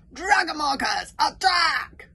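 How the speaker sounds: slow, high-pitched battle shout; Valhallarama. Acted. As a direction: bellow to rally a whole army. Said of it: "had a jaunty Valhallarama effect".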